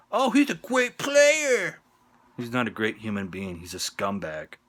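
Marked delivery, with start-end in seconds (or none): 0.1-1.8 high, loud, drawn-out mock praise; mocking fan voice. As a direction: parrot the fans in a silly high voice. Mocks what fans say.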